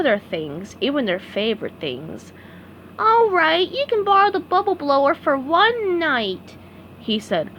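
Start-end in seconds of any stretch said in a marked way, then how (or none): 3.0-6.6 SpongeBob voice. A narrator's reading. SpongeBob giving in.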